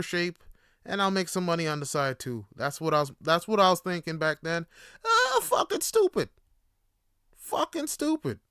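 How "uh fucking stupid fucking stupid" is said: self-mocking. Real, not acted. Mocking his past decision.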